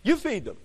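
You pushed at the listeners; emphatic you. Shown as a demonstration. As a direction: lean on You as a challenge. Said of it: "He's throwing it down to them".

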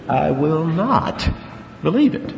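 a firm, slowed-down "I will not believe it"; strong negative. Retold or imitated. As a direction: draw out "I will not" and hit not. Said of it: "Very strong negative in the Greek".

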